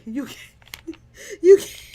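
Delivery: squealing through laughter; breaking into a laughing shriek; can barely get it out for laughing. Real, not acted. Laughing fit at a joke.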